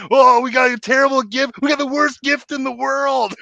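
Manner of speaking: hammed-up wail of disappointment; mock disappointment. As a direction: bemoan it in a high, exaggerated voice. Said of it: "they were so disappointed".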